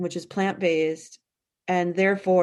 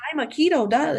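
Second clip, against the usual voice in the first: higher-pitched mimicry of a self-labeled expert; mock practitioner; 8 semitones higher, faster than usual. Imitating practitioners who push one diet, mock pitch-raised recital. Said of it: "we see them saying".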